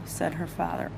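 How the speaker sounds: ordinary delivery, nothing marked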